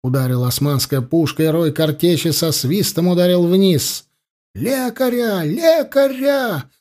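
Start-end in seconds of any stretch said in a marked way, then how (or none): 4.5-6.7 high-pitched, drawn-out call for a medic; acted cry from the wounded ranks; cry for help. A narrator's reading. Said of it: "закричали сразу несколько голосов".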